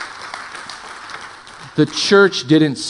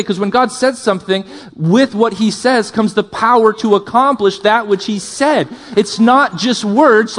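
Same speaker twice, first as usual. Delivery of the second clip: preaching that climbs higher as it goes; impassioned preaching; 6 semitones higher, 3 dB louder than usual. Real, not acted. Preacher rousing the congregation as prophetic people, impassioned. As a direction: build with raised pitch to an emphatic declaration.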